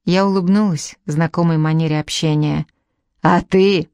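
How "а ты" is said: louder sharp snap; old woman barking at the prince to leave. A narrator's reading. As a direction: bark it at the prince. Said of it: "рявкнула она Николаю".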